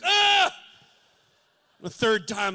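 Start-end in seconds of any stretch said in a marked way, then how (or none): 0.0-0.5 loud, high startled cry. Retold or imitated. Son jolted again by his father's breath, loud cry. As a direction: jump at the surprise breath. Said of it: "I'm like".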